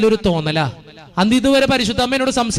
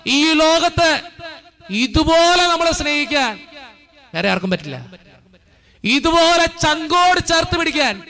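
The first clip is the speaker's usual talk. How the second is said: raised, insistent preacher's voice, building line by line; fervent preaching; 8 semitones higher, slower than usual. Real, not acted. Priest urging the congregation in church, full fervent preaching. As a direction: drive each line home to the faithful.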